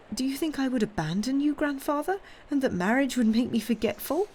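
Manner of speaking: high, incredulous question; hurt granddaughter's anxious plea; pleading protest. A narrator's reading. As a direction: appeal earnestly, pitch held right up. Granddaughter protests she would never abandon him.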